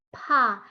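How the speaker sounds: "pa" with a puff of air after p; aspirated P. Shown as a demonstration. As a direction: say "pa" like "pha", with an h. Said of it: "ada tambahan H-nya". ("pa" dengan embusan bunyi h)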